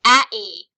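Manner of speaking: "a-e" as happy without its consonants, loud and open; vowels only. Shown as a demonstration. Teacher demonstrating syllables, vowels only. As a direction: say "a-e" without closing your mouth. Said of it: "without closing your mouth".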